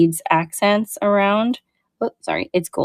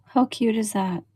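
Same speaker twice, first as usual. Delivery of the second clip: ordinary delivery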